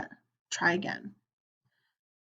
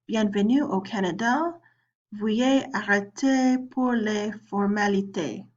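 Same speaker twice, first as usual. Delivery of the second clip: French accent. Shown as a demonstration. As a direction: read it in a careful learner's French accent. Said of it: "That's my French accent".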